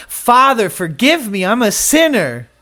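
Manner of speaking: high, loud plea for mercy; crying out to God. Retold or imitated. Sinner crying out to God for forgiveness, loud and pleading. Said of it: "cry out to God".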